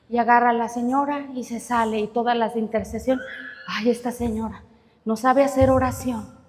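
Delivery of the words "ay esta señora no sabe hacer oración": scoffing aside. Acted. Prayer ladies scoffing.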